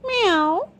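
high mewing meow; cat meow. Acted. Cat brushing off the hen, high meow. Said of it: "replied the cat".

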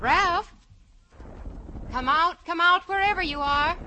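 loud teasing call; calling like hide and seek; sing-song taunting. Acted.

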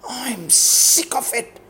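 slow, venomous hiss through the teeth; a bitter, drawn-out hiss. A narrator's reading. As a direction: spit the words slowly with venom. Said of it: "the girl hissed".

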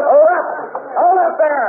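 police shout mid-chase; shouting at suspect. Acted. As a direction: yell it after a running man. Cop yells at fleeing robbers.

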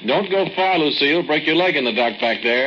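calling out. Acted. Calling into the cave after Lucille.

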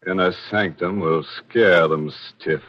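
normal talking voice

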